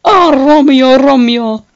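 mock swooning lover. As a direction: cry out to Romeo with exaggerated longing. Lover calling Romeo.

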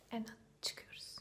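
hushed whisper. Real, not acted. Leaving the house quietly. (fısıltıyla)

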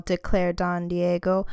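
usual speaking voice

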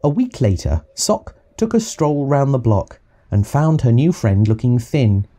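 usual speaking voice

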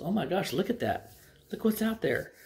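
high-pitched exclamation of surprise; acted amazement. Texan pointing out something outside the plane window, amazed. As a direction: exclaim in wonder, pitch jumping up.

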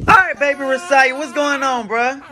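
father greeting his infant son. Real, not acted.

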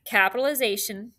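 usual speaking voice

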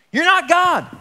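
high, loud declaration; emphatic exclamation. Real, not acted. Preacher telling the congregation, loud and emphatic. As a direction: proclaim it with force.

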